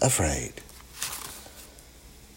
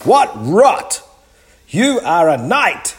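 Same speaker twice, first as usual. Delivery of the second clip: high, loud scoffing; storybook giant loudly objecting; giant's cry; 10 semitones higher, 6 dB louder than usual. A narrator's reading. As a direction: call out the bluff at full voice. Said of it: "cried the giant".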